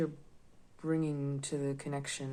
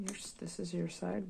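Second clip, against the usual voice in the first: whispering; 3 semitones higher than usual. Real, not acted. Laying out the tarot cards.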